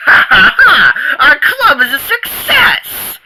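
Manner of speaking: high cackling victory laugh; laughing triumph. Acted. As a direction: laugh through the boast.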